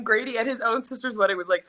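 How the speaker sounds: ordinary talk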